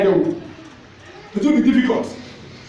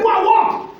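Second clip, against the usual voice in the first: mock shout; 10 semitones higher than usual. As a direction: bark it like a father. Preacher voicing father's command.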